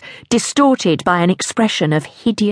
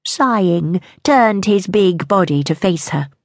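ordinary delivery

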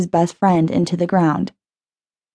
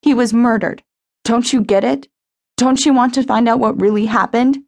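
higher, upset insisting; tearful pleading; 4 semitones higher than usual. A narrator's reading. Jenny demanding Sean want the truth, higher and tearful. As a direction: push through the tears, understated.

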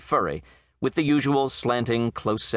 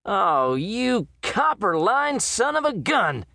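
slow, much higher, exasperated yell; 11 semitones higher, slower than usual. A narrator's reading. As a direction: let the exasperation burst out at the animal. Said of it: "he yelled at the animal".

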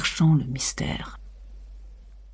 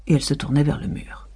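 plain reading voice

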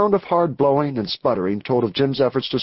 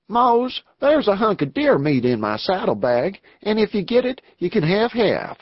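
higher-pitched cowboy voice; outlaw offering food; 8 semitones higher than usual. Acted. Outlaw offers hungry comrade deer meat, higher-pitched cowboy voice. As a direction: lift the voice into another gang member.

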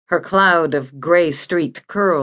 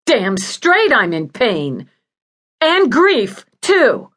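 emphatic, raised-pitch snapping back; bitter retort; 7 semitones higher, 5 dB louder, slower than usual. Acted.

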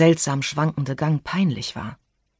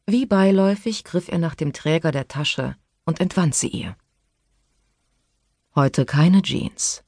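ordinary delivery, nothing marked

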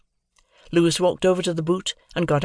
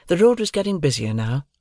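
usual speaking voice

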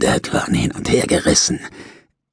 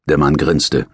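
ordinary delivery, nothing marked

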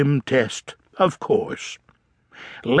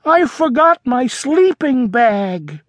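worried Froggy; 7 semitones higher than usual. Acted. Froggy confessing to Dad in the car, higher worried voice. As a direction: blurt the bad news.

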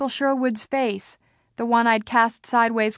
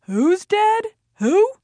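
high, loud confused father woken from sleep; a sleepy dad calling out from bed; dad half asleep, loud and high; groggy father; 11 semitones higher, 4 dB louder, much slower than usual. A narrator's reading. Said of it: "My father saying".

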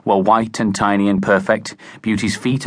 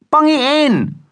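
very high, loud yell; boy yelling at the wicket; 4 dB louder, slower than usual. A narrator's reading. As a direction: yell across the field. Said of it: "yelled Spadger Garrett".

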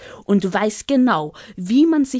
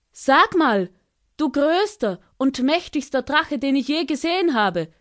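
high, flattering little bird calling up to the dragon; Ara ruft schmeichelnd; 7 semitones higher than usual. Acted. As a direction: call out sweetly to flatter him. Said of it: "rief sie ihm zu". (hoch und schmeichelnd rufender kleiner Vogel)

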